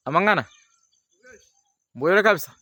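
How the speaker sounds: loud high call across a distance. Acted. Msakuro yelling to the lady far ahead. Calling out from afar.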